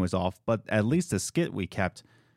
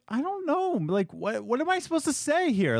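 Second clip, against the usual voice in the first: flustered high-pitched retelling; 14 semitones higher than usual. Retold or imitated. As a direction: voice jumps much higher, lost and unsure. Retelling own hotline call, flustered.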